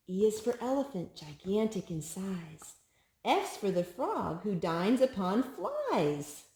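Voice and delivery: lilting picture-book read-aloud; sing-song storybook reading; reading to a child. Acted. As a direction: read each rhyme brightly to a toddler.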